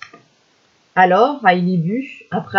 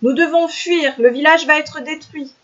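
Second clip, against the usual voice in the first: mild mock cry; 8 semitones higher than usual. A narrator's reading. Hunter urging villagers to flee, high mild cry. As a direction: sound the alarm with pitch rather than volume. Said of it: "cria-t-il".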